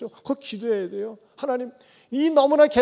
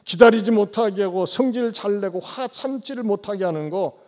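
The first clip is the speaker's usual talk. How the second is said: usual speaking voice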